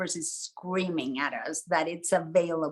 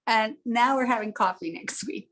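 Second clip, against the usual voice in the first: ordinary talk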